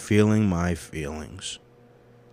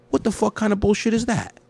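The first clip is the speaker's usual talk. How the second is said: high, indignant outburst at his own advice; outraged rant; 13 semitones higher, 3 dB louder than usual. Real, not acted. A man ranting that his own advice fails him, outraged. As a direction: throw the question up in disbelief.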